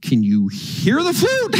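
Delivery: slow start leaping high; incredulous question. Retold or imitated. Preacher asking deaf woman about the flute, incredulous. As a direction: let the pitch shoot up in comic disbelief.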